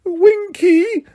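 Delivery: high, slow whimpering elf voice; Winky whimpering about her master's need. A narrator's reading. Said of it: "whimpered the elf".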